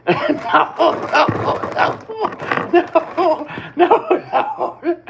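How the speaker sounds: high, loud laughing nos; hyena-like laughing. Retold or imitated. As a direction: laugh out each no like a crazy hyena. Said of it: "it sounded like a crazy hyena again".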